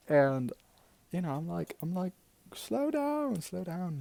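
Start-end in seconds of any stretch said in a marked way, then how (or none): 2.5-4.0 high, drawn-out mock plea; urging caution. Warning your mum about her drinking, urgently. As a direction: urge it in a raised pitch.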